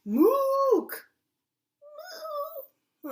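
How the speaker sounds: high, loud, strained moose call; failed moose call. Acted. Teacher acting the bunny's bad moose call for kids. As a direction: bellow a clumsy moose call that misses. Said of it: "Let's see what kind of moose calls".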